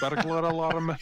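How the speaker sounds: Scottish accent party trick. Shown as a demonstration. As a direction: show off the Scottish burglar alarm. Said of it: "I'll give you a bit of my Scottish".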